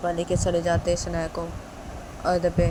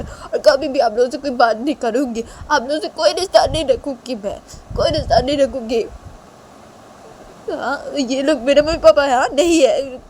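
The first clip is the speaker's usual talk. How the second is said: raised, higher tearful defiance; hurt defiant daughter; 6 semitones higher, 5 dB louder than usual. Acted. Daughter vowing never to return to father, raised and tearful. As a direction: spit out goodbye through hurt.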